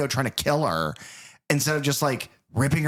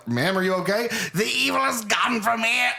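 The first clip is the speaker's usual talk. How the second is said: high, frantic freak-out; panicked impression, pitched way up; Loomis; 9 semitones higher than usual. Loomis blurting to the woman after a brief nicety, frantic impression. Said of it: "he just freaks the fuck out".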